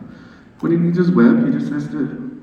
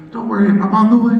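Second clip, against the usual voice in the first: heroic reassurance; 7 semitones higher, 6 dB louder than usual. Retold or imitated. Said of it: "He goes".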